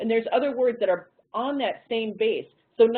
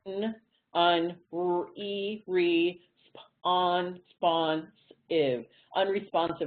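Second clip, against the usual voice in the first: segment by segment, sounding out; choppy syllable-by-syllable decoding; staccato; much slower than usual. Shown as a demonstration. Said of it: "go back and decode it".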